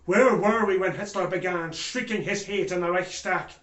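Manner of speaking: forceful, indignant courtroom outcry; impassioned accusation. Acted. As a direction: hammer each WHERE WERE WE. Impassioned accusation of himself and nation.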